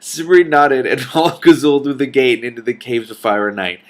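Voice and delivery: reading through giggles; laughing while reading. Real, not acted. Reader laughing at the dragon's joke, giggling on. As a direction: chuckle through the line.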